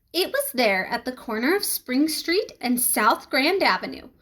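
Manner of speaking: plain reading voice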